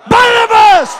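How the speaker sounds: loud angry crowd shout; crowd shouts angrily. Pretend. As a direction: shout the name as an angry mob. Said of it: "You are very angry".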